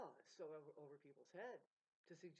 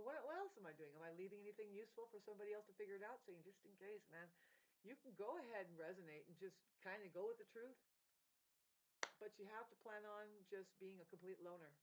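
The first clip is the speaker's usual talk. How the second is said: ordinary delivery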